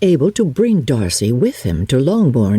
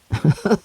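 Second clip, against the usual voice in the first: short rising amused laugh; a quick delighted chuckle; 6 semitones higher than usual. Acted. Mr Bennet enjoying the absurd letter: short rising chuckle.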